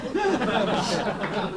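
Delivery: short real laugh at own joke. Real, not acted. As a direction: laugh at the punchline.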